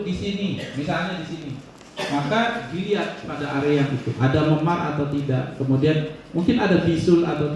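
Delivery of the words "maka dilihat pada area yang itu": ordinary talk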